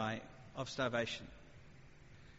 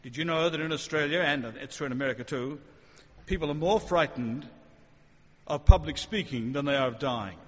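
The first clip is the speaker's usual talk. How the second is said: lightly mimicked earnest public speaker, swelling louder; lightly mocking motivational talk; mocking speech voice; 8 dB louder than usual. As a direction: parody a pompous statistic-quoting speaker. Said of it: "You may have heard speeches like this".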